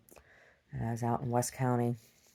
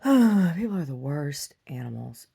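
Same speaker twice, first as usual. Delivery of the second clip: weary sigh; 6 dB louder, slower than usual. Real, not acted. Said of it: "repeated with a sigh".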